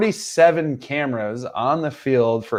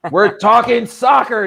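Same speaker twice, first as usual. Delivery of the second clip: exasperated; 9 semitones higher, 5 dB louder than usual. Real, not acted. Exasperated correction of chat.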